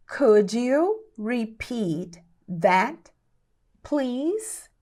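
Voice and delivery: very slow, word by word, rising on please; slow teaching pace. Shown as a demonstration. English teacher models asking for a repeat, very slowly. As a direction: spell it out slowly with pauses.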